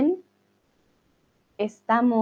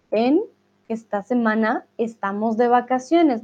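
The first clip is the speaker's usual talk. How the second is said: ordinary talk, nothing marked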